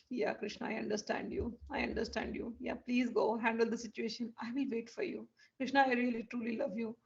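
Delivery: very soft, hushed devotion; tender acceptance. Acted. Chandravali letting Krishna go, softly and tenderly. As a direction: quietly give in with love.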